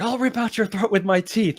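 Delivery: high, strained battle cry; imitated ferocious charge. Girl charging at the angel, imitated ferocity. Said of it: "the tone of her, her charge earlier".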